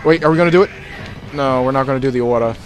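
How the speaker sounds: ordinary talk, nothing marked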